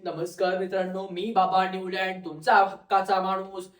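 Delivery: low, monotone self-introduction in character; Newlands. Acted. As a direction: play the old scientist greeting students in a low voice. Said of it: "मग बाबा न्यूलँड आले". (खालच्या सुरात बाबांची भूमिका)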